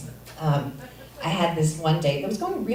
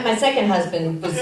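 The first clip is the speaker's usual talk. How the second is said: normal talking voice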